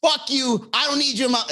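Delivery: high, loud, petulant yelling; mock angry outburst. A man imitating an angry dater yelling that he's right. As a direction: yell back like a sore loser. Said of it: "But if I'm angry".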